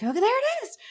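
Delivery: high, loud excited cry; screaming. Retold or imitated. Screams to producer and Chrissy in the ear, screaming. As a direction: shriek the find into the earpiece. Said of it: "I'm screaming at my".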